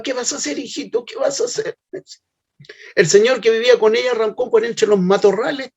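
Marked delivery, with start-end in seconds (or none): 0.0-1.8 high, pleading mother's voice, much higher; desperate trembling plea. Retold or imitated. Son retells his frightened mother begging him, high desperate plea. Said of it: "Mi mamá tiritaba, desesperada".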